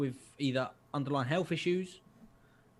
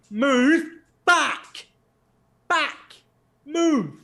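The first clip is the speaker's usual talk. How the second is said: high, loud one-word orders; shouted command; 12 semitones higher, 7 dB louder, much slower than usual. Retold or imitated. Shopper orders a non-English speaker back, acted loud shouting. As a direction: shout it in separate bursts for someone who doesn't understand. Shouting at man who didn't understand.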